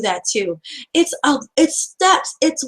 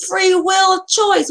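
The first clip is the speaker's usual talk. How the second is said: emphatic shout; 7 semitones higher, much slower than usual. Real, not acted. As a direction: belt out each word slowly, like a preacher's climax. Speaker drives home main point.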